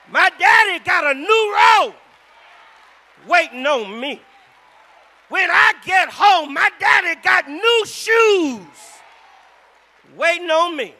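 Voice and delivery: high, loud, slow shouted preaching; preaching at full force. Acted. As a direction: build the sermon to a roaring climax.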